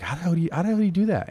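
high, fast, frantic disbelief; flustered cook stumped by orecchiette, frantic; frantic exasperation. Retold or imitated. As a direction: blurt it out helplessly fast, voice shooting up. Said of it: "I'm like".

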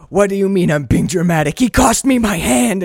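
loud protest rising to high outrage; hammed-up wounded fury; mock indignation. Imitating [name] answering [name], mock indignation. Acting out his indignant retort.

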